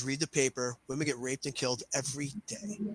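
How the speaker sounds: quiet, low voice of a defeated student; resigned retort. Retold or imitated. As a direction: mutter it softly, as if given up. Resigned woman answers him in story.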